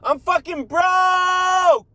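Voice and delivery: high, slow, loud wail of frustration; screaming that you're broke; desperate shout. Real, not acted. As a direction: drag out the shout like you're at the end of your rope. Venting about being broke.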